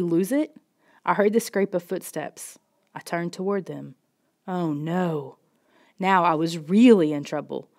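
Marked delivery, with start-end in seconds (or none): none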